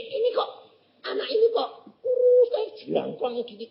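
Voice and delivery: startled thought. Retold or imitated. Preacher startled by the skeletal youth, high amazed exclamation. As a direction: gasp at how thin he is.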